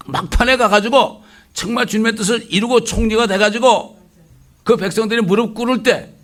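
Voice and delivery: loud, high preaching at full force; fiery preaching. Acted. Preacher rebuking today's churches, loud and high.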